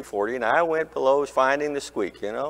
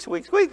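squeaky car noise. Retold or imitated. Pastor mimicking the squeaks coming back after rain, high squeaky voice.

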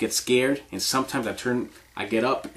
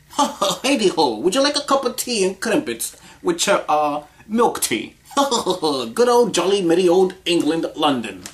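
hammed-up, higher-pitched London English accent; 5 semitones higher than usual. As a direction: play a jolly mock Londoner. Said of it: "she was from London".

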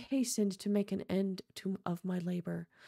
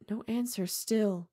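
ordinary delivery, nothing marked

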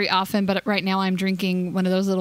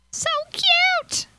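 squeal. Real, not acted. Gamer squealing at her cute potatoes, very high. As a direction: squeal it out in delight.

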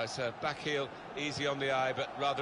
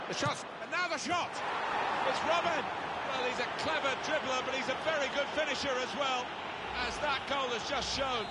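high, thrilled play-by-play; excited goal call; 9 semitones higher than usual. Acted. Commentator praising Robin's goal, excited. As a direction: keep the pitch up high through the praise.

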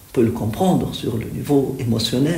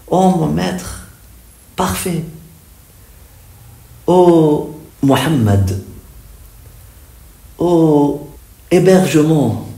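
raised, drawn-out reverent call; devotional invocation; 2 semitones higher, 5 dB louder than usual. Acted. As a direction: call out to the beloved master, pausing between phrases. Recites the poem's invocation. (invocation fervente et solennelle)

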